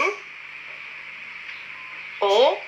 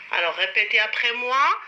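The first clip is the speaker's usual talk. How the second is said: ordinary delivery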